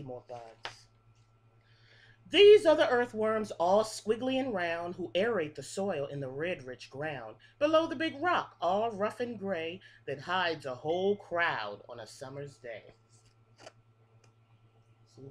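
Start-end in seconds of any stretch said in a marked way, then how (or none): 2.3-12.9 lilting picture-book read-aloud, each line rising then falling; reading to children. Acted. Teacher reading a bug rhyme book to her class, sing-song. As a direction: read the rhyme like story time for little kids.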